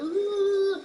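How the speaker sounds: high, drawn-out mock "ooh"; mock whine of pain. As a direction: imitate a child's fake little wail. Imitating a minor hurt cry.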